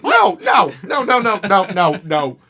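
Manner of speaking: loud squeaky string of protests, gradually coming down; high-pitched confused voice. Retold or imitated. Worker refusing the customer who hit him, high confused voice falling away. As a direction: start in a very high shout, sink toward normal pitch. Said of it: "my high-pitched, like, I'm confused voice".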